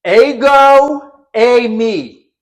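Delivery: high, loud and very slow declaration; shouting back. Retold or imitated. Jesus shouting his divine name back at the soldiers, slow and loud. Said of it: "Jesus shouts back".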